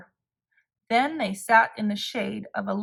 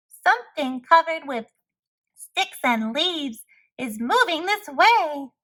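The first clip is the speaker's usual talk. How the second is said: higher and louder, alarmed squirrel; cried; 5 semitones higher, 4 dB louder than usual. A narrator's reading. Squirrel warning the animals of the monster, alarmed. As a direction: warn everyone, scared. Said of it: "cried a squirrel".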